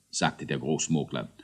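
usual speaking voice

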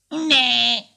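high little ghost squeal; squeaked denial. A narrator's reading. Little ghost denies nibbling the cake, squeaking. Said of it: "quiekte Knille".

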